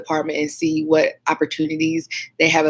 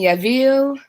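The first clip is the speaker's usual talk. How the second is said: Yavil said higher with a put-on foreign accent; accented name; 8 semitones higher than usual. Real, not acted. Reading a viewer's name off chat, Yavil with an accent. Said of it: "I said it with an accent like that".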